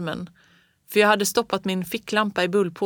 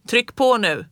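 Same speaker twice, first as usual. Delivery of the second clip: loud push-on call, a bit higher; urging shout; 4 semitones higher, 6 dB louder than usual. A narrator's reading. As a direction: urge him on over the wind. Father urging on the sled.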